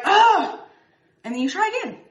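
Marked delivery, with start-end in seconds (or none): 0.0-0.6 high, loud frustrated groan; frustrated ugh. Retold or imitated. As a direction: let out an upset ugh. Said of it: "You're like, you're like".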